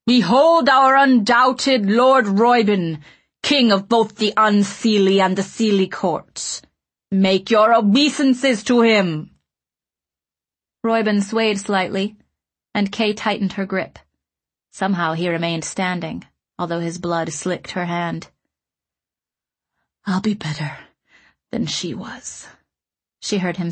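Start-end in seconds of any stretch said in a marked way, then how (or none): 0.1-9.3 loud, slow proclamation; calling out. A narrator's reading. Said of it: "Ruddles called out". 20.0-22.5 all breath, barely voiced; breathy voice. A narrator's reading. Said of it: "His voice was all breath".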